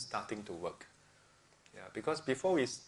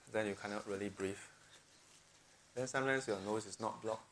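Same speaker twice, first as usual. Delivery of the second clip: ordinary talk, nothing marked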